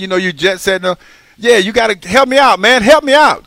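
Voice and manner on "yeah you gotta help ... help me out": high, loud plea for help; exaggerated pleading. Real, not acted. Host begging his busy colleague for tech help, pleading. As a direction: beg a pal, playing up the helplessness.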